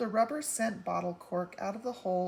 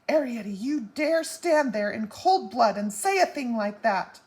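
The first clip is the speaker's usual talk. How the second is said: much louder, indignant outburst; outraged mother; 3 semitones higher, 9 dB louder than usual. A narrator's reading. Mother scolding her daughter for talking of borrowing, loud and outraged.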